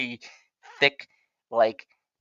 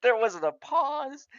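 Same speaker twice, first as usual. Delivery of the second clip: high-pitched, talking through helpless laughter; laughing through words; 12 semitones higher, 4 dB louder than usual. Real, not acted. Host cracking up over guessing the killer instantly, laughing hard. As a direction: crack up while getting the words out. Cracking up mid-sentence at own story.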